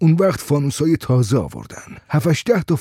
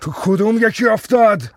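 high, forceful demand; angry shout; 8 semitones higher, 3 dB louder than usual. A narrator's reading. Father demanding which well his son fell in, shouting angrily. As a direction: yell the question, pitch up.

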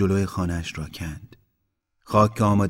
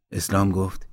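ordinary delivery, nothing marked

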